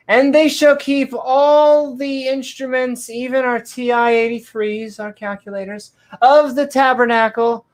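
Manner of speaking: hammed-up King James reading voice; mock solemn scripture. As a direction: intone it like a grand sermon.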